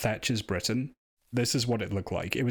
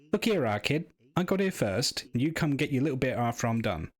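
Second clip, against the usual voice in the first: badly faked Scouse, pitched up; failed Scouse accent; 3 semitones higher than usual. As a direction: have a clumsy go at a Scouse accent. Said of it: "I can't do a Scouse accent".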